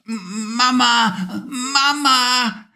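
put-on high, half-asleep child calling; sleepy boy calls for his mother. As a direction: whimper it drowsily, still dreaming. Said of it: "rief er noch etwas schlaftrunken".

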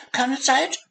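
high squeak rising at the end; squeaking mouse, rising. Acted. Hurried hazel mouse squeaks while racing past. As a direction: squeak again, tossed over the shoulder. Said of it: "fiepte die kleine Maus".

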